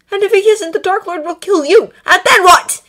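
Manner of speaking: high, loud yell; yelling back. A narrator's reading. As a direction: hurl it right back at him. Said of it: "Draco yelled right back".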